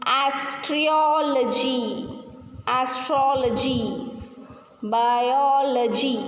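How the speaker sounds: slow "Astrology", "Biology", the O before "logy" stretched; stressed vowel demo. Shown as a demonstration. Teacher drills students, "Astrology" and "Biology" slowly stressed on the O. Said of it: "Stress the vowel sound".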